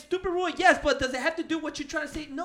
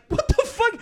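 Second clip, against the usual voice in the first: much higher, exasperated; retold disbelief; 12 semitones higher than usual. Reacting to commercials lecturing him, baffled retold outburst. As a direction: toss it out in baffled outrage. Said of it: "I'm like".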